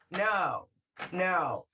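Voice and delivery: ordinary talk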